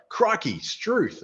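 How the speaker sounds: high-pitched exclaimed Aussie catchphrases; Steve Irwin. Retold or imitated. Trainer mimicking an over-Aussie chatbot, Steve Irwin impression. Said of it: "it generally turns into Steve Irwin".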